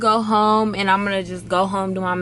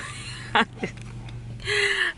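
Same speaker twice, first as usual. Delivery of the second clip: high laugh; 12 semitones higher, 7 dB quieter than usual. Real, not acted. Laughs at own accent.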